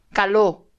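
"Caló" with a firm final o; "Caló" as ca-LO, second syllable punched; stress on O. Shown as a demonstration. Speaker repeating the correction, "Caló" stressed on O. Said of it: "Acento en la O".

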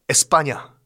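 ordinary delivery

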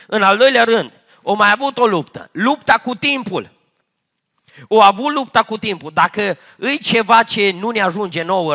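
loud, fervent preaching, driving each phrase home; preaching at full force. Acted. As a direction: proclaim it to the whole congregation. Fiery exhortation to congregation.

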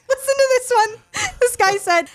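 high, laughing through the words; cracking up while introducing a comment. Real, not acted. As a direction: struggle to speak through real laughter.

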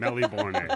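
high-pitched "Melbourne" as melly-bor-nay; joke mispronunciation. Shown as a demonstration. Trolling Australians about their city, high-pitched melly-bor-nay for Melbourne. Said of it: "That's how you actually say the name".

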